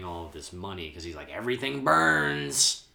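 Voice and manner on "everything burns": slow, raised, drawn-out villain line; imitated villain. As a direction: stretch it out like a hammed-up villain. Acting out Joker burning the money.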